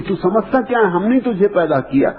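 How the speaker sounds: imitating an indignant father, pitched high; retold fatherly anger, high and insistent; angry father. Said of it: "गुस्से में आ गए कि".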